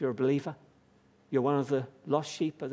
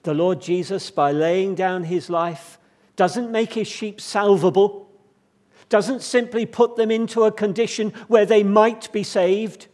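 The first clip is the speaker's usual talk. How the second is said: higher, louder impassioned preaching; fervent preaching; 5 semitones higher, 8 dB louder than usual. Real, not acted. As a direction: proclaim it with rising fervour to the congregation. Preaching at full force.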